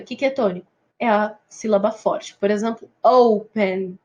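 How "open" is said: "open" with a strong first syllable; stress on first syllable. Shown as a demonstration. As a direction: stress the O in "open", let "pen" fall away. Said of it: "sílaba tônica".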